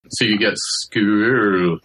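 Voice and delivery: school with a slow, rounded oo; rounded vowel demo. Shown as a demonstration. Linguist demonstrating the rounded oo in school. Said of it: "trying to get the rounding onto the vowel".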